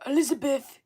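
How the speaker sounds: a hint of a hesitant character, halting on the ums; male character's voice. Shown as a demonstration. Streamer demonstrating his puppet character's voice, lightly put-on. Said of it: "He talks like that".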